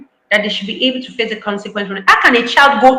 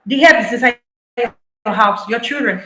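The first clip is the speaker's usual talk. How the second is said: ordinary delivery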